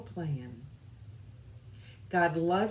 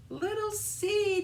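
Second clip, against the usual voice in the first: calling out high, searching; shouting; 14 semitones higher, slower than usual. Acted. Little Fox calling for his lost friend, shouting. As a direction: call the name out high across the farm. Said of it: "he shouted".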